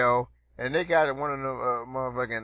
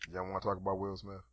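lower, softer put-on deep voice; deeper voice; 6 semitones lower, 12 dB quieter, faster than usual. Host teasing cohost in a low deep voice. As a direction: drop it low like a radio man. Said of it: "You like my deeper voice".

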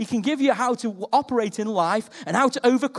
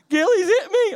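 put-on high, louder childish wail; boy telling his mum he got hit; 11 semitones higher, 6 dB louder than usual. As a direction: cry to mum like a hurt kid.

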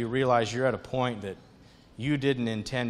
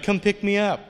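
taunting little voice, higher; mocking receipt; 7 semitones higher, 4 dB louder than usual. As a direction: tease like a paper daring you. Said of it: "mocking me".

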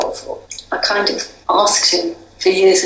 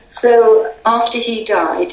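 ordinary talk

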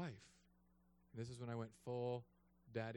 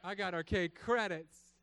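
gloating taunt; 7 semitones higher, 7 dB louder than usual. Retold or imitated. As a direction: rub it in with glee. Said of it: "I said".

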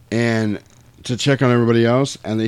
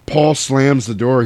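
ordinary delivery

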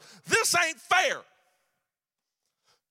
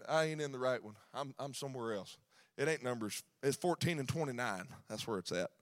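much softer, low muttered aside to himself; 11 semitones lower, 12 dB quieter than usual. Real, not acted. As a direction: drop away to check the verse. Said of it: "that ain't right".

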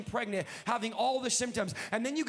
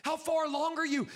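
ordinary delivery

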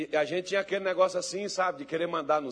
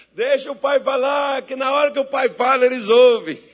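raised, shouted appeal; real, amused preacher's shout; loud exhortation; 8 semitones higher, 12 dB louder than usual. Real, not acted. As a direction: shout the moral of the story, high and loud. Preacher exhorts loudly, breaking into laughter.

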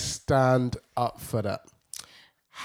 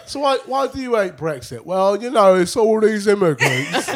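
mock interview voices; 6 semitones higher, 6 dB louder than usual. Mocking an interviewer and Brexit voter.

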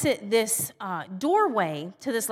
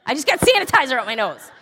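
louder, quicker mock outburst; exasperated outburst; 6 dB louder, faster than usual. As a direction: blurt it out fast and loud, half laughing. Fed-up comeback to husband, retold.

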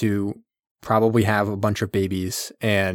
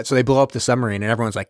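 usual speaking voice

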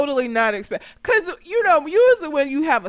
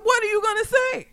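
ordinary delivery

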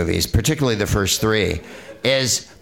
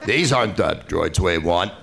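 John Wayne impression; 5 semitones lower than usual. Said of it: "John Wayne".

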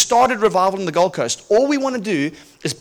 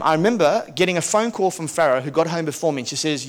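ordinary talk, nothing marked

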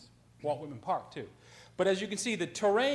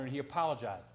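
normal talking voice

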